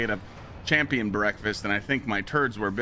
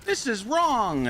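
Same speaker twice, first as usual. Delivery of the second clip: imitated girlfriend, very high-pitched protest; mock scolding in a squeaky-high register; mock indignant yelling. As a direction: put on a high, outraged woman's voice. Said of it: "his girlfriend starts yelling".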